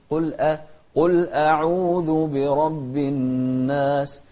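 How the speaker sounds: loud, slow recited "قل أعوذ" with a thin hamza; light hamza. Shown as a demonstration. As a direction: chant "قل أعوذ", keeping the a light. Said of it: "ونرقق الهمزة". (ترقيق الهمزة في ترتيل "قل أعوذ")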